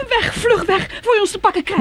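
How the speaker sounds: high, loud frightened pleading; terrified cry. Acted. Woman to companion, crying out high and loud in fear. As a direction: scream at him to drive away. Fleeing the creature in terror.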